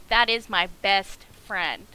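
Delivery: "That is my best friend" loud, each word weighted; slow emphatic repeat. Real, not acted. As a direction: spell out "That is my best friend" slowly and forcefully.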